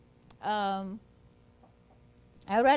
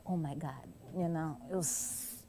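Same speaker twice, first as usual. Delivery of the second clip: embarrassed; 8 semitones lower, 10 dB quieter than usual. Retold or imitated. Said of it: "he was embarrassed".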